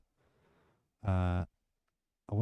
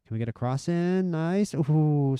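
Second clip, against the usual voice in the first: higher and louder, rising hope on the cross; excited cheer; 7 semitones higher, 6 dB louder, slower than usual. Real, not acted. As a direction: cheer the attack on, voice jumping up. Near chance, cheering cross in.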